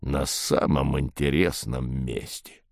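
low, slow grumble of regret; disappointed. A narrator's reading. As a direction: sulk over the lost ending. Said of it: "разочарованно проговорил Двуглавый".

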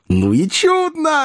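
high, drawn-out mock yell. A narrator's reading. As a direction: cry it out high without much volume. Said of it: "орал Коровьев". (восторженный высокий выкрик)

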